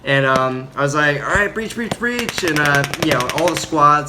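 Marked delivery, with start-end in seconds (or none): 1.2-2.4 high, retold breach call. Marine sending squad over the wire, high mock call-out.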